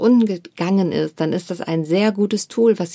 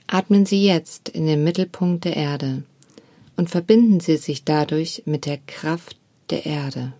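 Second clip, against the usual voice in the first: guided meditation. Acted. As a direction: guide the listener gently into stillness. Leads a meditation.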